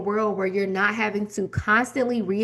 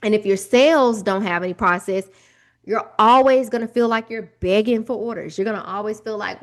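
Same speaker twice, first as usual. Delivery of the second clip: normal talking voice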